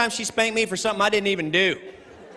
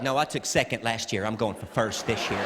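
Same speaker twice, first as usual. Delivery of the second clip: low, softer deadpan comeback; unfazed mother; 7 semitones lower, 5 dB quieter than usual. Retold or imitated. Mom answering her child's insult, low deadpan. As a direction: imitate a mom coolly unbothered, dropping low. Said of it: "I'm like, "Mom".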